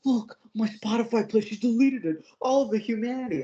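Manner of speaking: high, whiny mockery of a crying kid; mock the boy's overblown wailing; high sarcastic whine over a deleted playlist; whiny mock Crispin. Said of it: "He's like".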